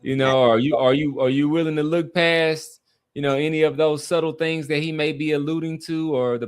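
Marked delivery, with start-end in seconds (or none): none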